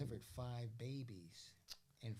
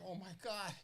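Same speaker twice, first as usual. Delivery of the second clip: panicked gasp; 9 semitones higher than usual. Retold or imitated. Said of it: "And I was like".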